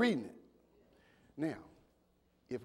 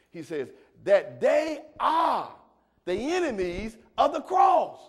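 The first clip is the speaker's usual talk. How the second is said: loud, higher, stretched preaching shout; full-force preaching; 9 semitones higher, 15 dB louder than usual. Acted. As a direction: preach it to the back row, slowing on key words.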